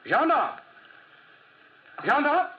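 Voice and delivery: high, loud summoning shout; calling out. Acted. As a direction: hail the policeman across the street. Calls policeman from carriage.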